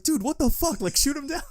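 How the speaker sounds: high, outraged mock yell; outraged king. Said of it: "And the king's like".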